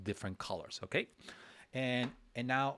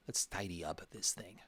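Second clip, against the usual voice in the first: whisper; 2 semitones lower, 6 dB quieter than usual. Real, not acted.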